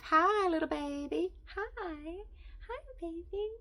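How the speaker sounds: sing-song hello to a tiny pet; high pet-talk greeting. Real, not acted. Cooing at her kitten.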